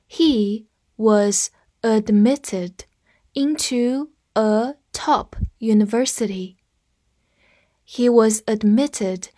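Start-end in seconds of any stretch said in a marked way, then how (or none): 0.1-6.6 word by word, slowly, for learners to repeat; slow read-along. Shown as a demonstration. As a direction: read slowly with small gaps between words. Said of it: "再来跟我读一遍". (慢速跟读，一词一顿)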